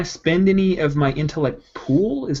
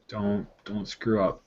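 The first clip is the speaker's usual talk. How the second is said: quiet; 8 semitones lower, 10 dB quieter, slower than usual. Real, not acted. Praying over the dice.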